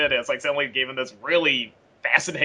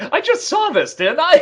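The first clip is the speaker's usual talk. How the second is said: high-pitched puzzled self-quote; puzzled disbelief. Retold or imitated. Commentator recalling flashback to animated episode, high puzzled disbelief. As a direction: voice a baffled double take, pitched way up.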